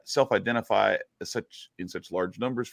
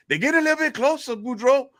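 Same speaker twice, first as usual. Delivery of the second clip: very high, anxious Cajun sidekick; hammed-up high Cajun voice; Cajun joke character; 4 dB louder than usual. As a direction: call the warning up to your buddy. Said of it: "get into this character here".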